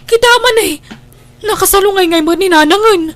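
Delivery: high, loud and rushed; wife frantically urging her husband to look at mother; panicked urging. Acted. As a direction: hurry him in alarm.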